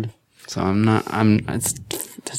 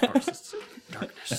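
laughing whisper; 9 dB quieter, much slower than usual. Acted. Repeating the joke, laughing.

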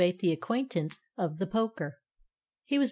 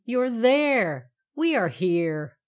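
called out; 3 semitones higher, 6 dB louder, much slower than usual. A narrator's reading. Righty correcting the hidden poker, calling out. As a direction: call back, stressing each place. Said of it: "called Righty".

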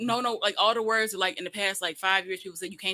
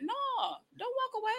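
high, softer call after someone; falsely innocent high protest; mock pleading; 5 dB quieter than usual. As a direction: taunt them in a pitched-up voice. Acted taunt as manager leaves.

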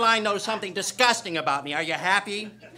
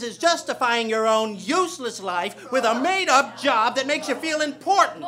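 normal talking voice

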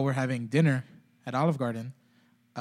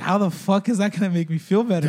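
raised, louder retold outrage; retold outburst; 8 semitones higher, 10 dB louder than usual. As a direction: vent it like you're reliving the anger. Retelling his angry reaction.

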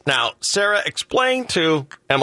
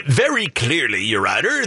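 ordinary talk, nothing marked

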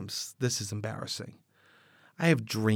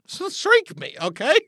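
much higher, eager begging; eager plea; 7 semitones higher, 4 dB louder than usual. Acted. Sock begging the host to shrink it, high eager plea. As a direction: beg to be shrunk like it's a gift.

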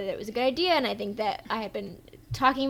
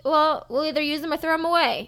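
egg seller; 5 semitones higher, 6 dB louder than usual. Retold or imitated. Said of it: "And he's like".

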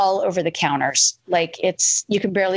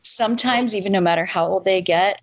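ordinary talk

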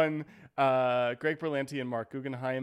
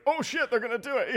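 higher, eager rush of excitement; excited; 9 semitones higher, 6 dB louder than usual. Retold or imitated. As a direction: blurt it out thrilled. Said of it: "I got excited".

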